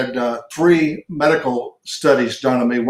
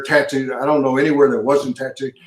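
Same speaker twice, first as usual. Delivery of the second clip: usual speaking voice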